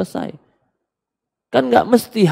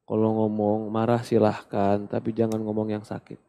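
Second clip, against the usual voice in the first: gentle whisper in husband's ear; 5 semitones lower, 8 dB quieter than usual. Shown as a demonstration. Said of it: "kau bisikkan di telinganya".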